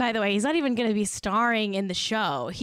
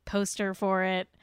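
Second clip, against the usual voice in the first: normal talking voice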